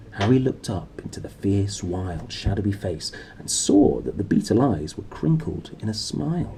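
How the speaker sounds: ordinary delivery, nothing marked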